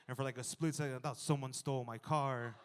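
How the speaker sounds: usual speaking voice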